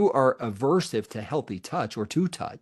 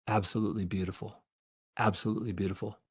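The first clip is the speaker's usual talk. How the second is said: softer, quicker murmur of admiration; soft tender tone; 6 dB quieter, faster than usual. Shown as a demonstration. Therapist showing the gentle tone a mother uses, soft and tender. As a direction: breathe the words out gently like to an infant. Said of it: "imagine that tone of voice, the softness".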